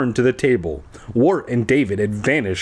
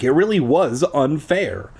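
ordinary delivery, nothing marked

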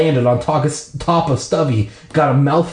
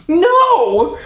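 very high, long panicked shriek; acted high-pitched horror; 4 dB louder than usual. A narrator's reading. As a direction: scream out to stop a disaster. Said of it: "let out a shriek".

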